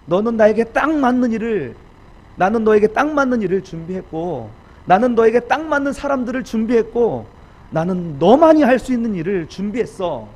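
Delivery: loud, high, rousing declarations; the Lord encouraging. Acted. God assuring the preacher of a fitting task, rousing. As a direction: proclaim each promise with full force. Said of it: "주님이 이렇게 말씀하시는 것 같아요".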